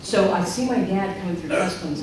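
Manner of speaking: ordinary talk, nothing marked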